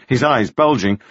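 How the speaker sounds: usual speaking voice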